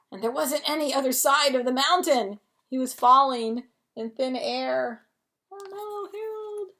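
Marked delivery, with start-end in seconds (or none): 5.5-6.7 high, soft oh no; mock alarm. Reader worried for falling Harold, high mock alarm. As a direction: gasp it up high for the kids.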